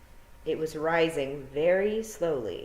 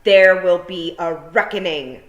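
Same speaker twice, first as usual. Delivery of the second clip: loud, slow threatening shout; 2 semitones higher, 12 dB louder, slower than usual. A narrator's reading. Tiffany threatening the hidden thieves, slow loud shout. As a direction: bellow it at the sky, slowly. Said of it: "she shouted at the sky".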